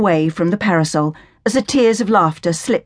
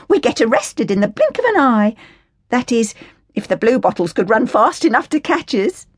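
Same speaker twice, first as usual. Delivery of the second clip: put-on higher, scornful working-class girl voice; indignant Cockney orphan girl; 6 semitones higher than usual. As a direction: sneer at the Sally Army in a higher, cheeky voice. Hilda complains about women's lot.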